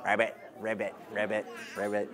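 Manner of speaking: low, soft croaking ribbit; frog sounds. Pretend. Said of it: "what does the frog say".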